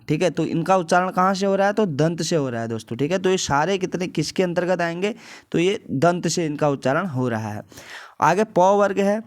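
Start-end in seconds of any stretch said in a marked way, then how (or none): none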